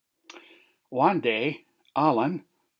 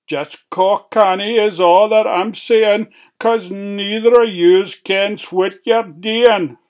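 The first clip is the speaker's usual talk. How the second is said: fretful warning; 6 semitones higher, 7 dB louder than usual. Acted. Alan warns, high and loud.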